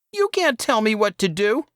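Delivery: put-on high, resentful kid brother voice; boy Saul, whining. A narrator's reading. Saul snaps at his older brother, high and resentful. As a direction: sneer it like a bratty younger boy.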